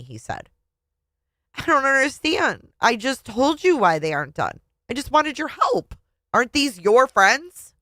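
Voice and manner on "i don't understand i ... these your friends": higher-pitched, pleading protest; exasperated protest. A narrator's reading. Wife answering her husband's blame, exasperated protest. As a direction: push back, hurt and baffled.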